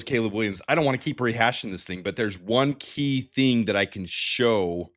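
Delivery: ordinary delivery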